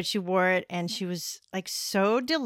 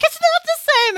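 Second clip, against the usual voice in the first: imitating an irate Scot in a high, loud yell; venomous mock outrage; 12 dB louder than usual. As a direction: explode like a hammed-up furious Scottish shopkeeper. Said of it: "venomous about it".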